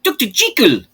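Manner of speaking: put-on Jekyll as "Jee-kyll" in a high, loud, laughing South African accent; mocking the film's South African Jekyll. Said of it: "they go very South African very quickly".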